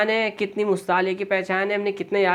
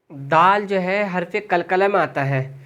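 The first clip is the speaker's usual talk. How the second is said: normal talking voice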